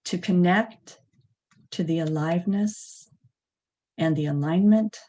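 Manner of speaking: unhurried, spacious meditation leading; guided meditation. Acted. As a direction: lead them gently into stillness, phrase by phrase.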